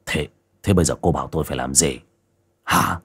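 low, quick and rattled; husband pressing his wife anxiously, low and fast; flustered husband. A narrator's reading. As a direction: blurt it out in a panic.

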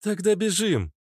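very high female voice; high falsetto exclamation; Natasha. A narrator's reading. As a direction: urge him on in a girlish voice. Natasha agrees to run.

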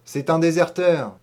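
the butcher declares it to the crowd; loud assertion. A narrator's reading. (affirmation forte et assurée)

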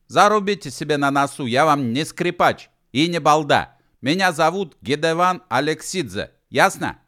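high, loud, heated self-defence; indignant outburst. A narrator's reading. Gedevan insists he is no fiddler, indignant outburst. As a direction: lay down the law, loudly offended. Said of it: "выкрикнул, сверкая глазами".

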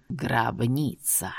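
low, slow, painful to hear; slow grating voice. A narrator's reading. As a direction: drag it out, harsh and eerie. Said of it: "медленно и больно для слуха произнёс он".

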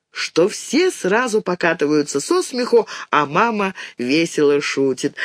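plain reading voice

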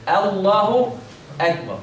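"الله أكبر" with a light a, rising then falling after a break; light hamza. Shown as a demonstration. Teacher modelling the correct call, light hamza. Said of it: "تقول".

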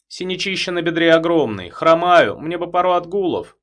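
groaning for sympathy. A narrator's reading. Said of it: "закряхтел, взывая к сочувствию".

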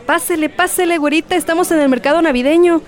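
high, fast, hawking stall patter; market vendor barker. Acted. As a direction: call customers into your stall. Vendor calling in Christmas market.

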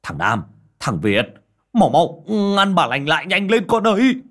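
high, loud urgent yell; panicked shout. Acted. Old man yelling to boys to stop widow. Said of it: "hoảng hốt vội vàng hô lên".

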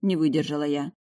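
plain reading voice

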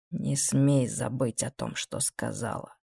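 low, slow, quiet menace; hushed order with hidden threat; veiled threat. Acted. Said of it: "с затаённой угрозой в голосе".

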